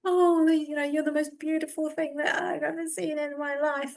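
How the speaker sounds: high, cooing adoration; gushing adoration. Retold or imitated. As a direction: gush as if besotted with a child. Adoring words to a child, retold.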